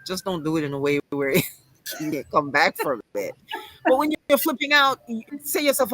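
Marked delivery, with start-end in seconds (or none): none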